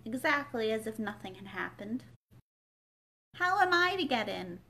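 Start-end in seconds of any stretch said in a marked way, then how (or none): 3.3-4.6 Alice raising her voice, higher; a louder, insistent question. A narrator's reading. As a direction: call out to be heard at the door. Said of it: "asked Alice again in a louder tone".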